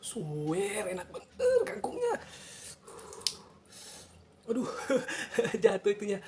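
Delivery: talking through laughter, high; laughing. Real, not acted. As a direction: giggle out the words. Laughing while eating.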